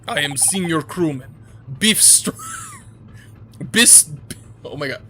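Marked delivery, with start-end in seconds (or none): 0.1-1.3 put-on loud proud Russian-accented sailor. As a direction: puff up like an offended Russian crewman.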